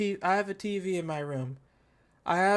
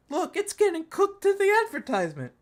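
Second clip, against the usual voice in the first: much higher, incredulous outburst; can't believe what the ad shows; excited disbelief; 10 semitones higher than usual. Real, not acted. Laughing disbelief at the ad.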